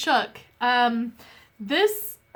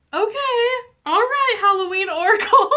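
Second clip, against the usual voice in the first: high, loud, laughing through the words; 12 semitones higher, 8 dB louder than usual. Real, not acted. Vlogger laughing off her emotion after a moving card, high and loud. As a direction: shake off the tears with a laugh.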